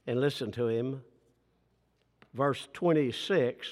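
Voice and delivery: ordinary talk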